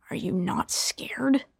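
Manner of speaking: slow, low, soft menace; menacing whisper. Acted. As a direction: whisper it slowly, unnerved. Killer menacing question.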